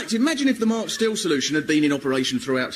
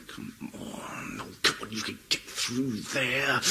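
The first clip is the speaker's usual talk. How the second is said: tense muttering driver; 5 semitones lower, 9 dB quieter, slower than usual. Retold or imitated. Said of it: "the English drive, going".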